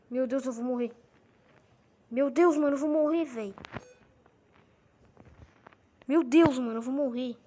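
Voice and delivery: loud panicked cries; panicking gamer. Real, not acted. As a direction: yell it like the zombies are on you. Overrun by a zombie horde. (gamer em pânico gritando)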